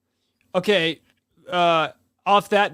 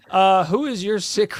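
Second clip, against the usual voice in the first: ordinary talk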